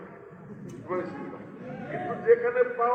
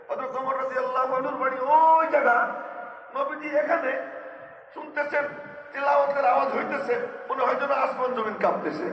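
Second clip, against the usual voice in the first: raised, ringing sermon voice; fervent preaching; 6 semitones higher, 5 dB louder than usual. Acted. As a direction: preach it out with rising fervour. Rising fervour describing recitation. (উচ্চস্বরে আবেগময় ওয়াজ)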